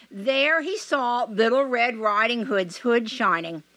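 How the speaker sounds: usual speaking voice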